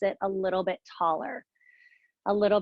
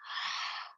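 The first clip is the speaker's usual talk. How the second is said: open-mouth sighing exhale; 8 dB quieter than usual. Shown as a demonstration. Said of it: "If you were to go like this".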